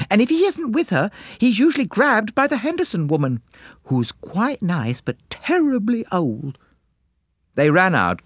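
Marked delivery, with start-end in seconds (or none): 0.1-6.6 put-on high, excited girlish chatter; gushing girls. A narrator's reading. Two girls gossiping about the colonel, high gushing. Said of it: "the two girls exclaimed".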